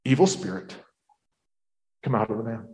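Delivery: low, measured command with pauses; quiet command. Acted. Jesus to the evil spirit in the man, quiet command.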